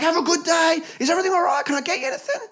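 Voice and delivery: very high, loud mock-cheery patter; over-nice staff. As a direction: gush at the customer, sickeningly helpful. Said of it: "they're fucking too nice".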